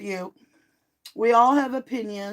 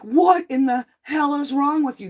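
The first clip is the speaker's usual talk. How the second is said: loud, higher-pitched outraged demand; retold yelling; 5 semitones higher, 5 dB louder than usual. Babysitter yelling at the grandmother, mock yell. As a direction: imitate someone yelling in outrage. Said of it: "when they're yelling".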